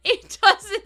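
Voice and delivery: loud, high words through laughter; giggling, high-pitched exclamation; laughing. Real, not acted. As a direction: squeeze it out while cracking up. Still cracking up about the postman.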